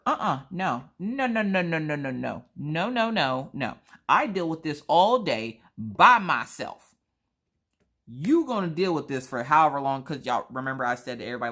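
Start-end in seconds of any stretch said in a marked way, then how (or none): 0.1-3.8 emphatic playful no. Real, not acted. Refusing to fetch daughter. 4.1-10.0 emphatic venting. Real, not acted. Venting at husband.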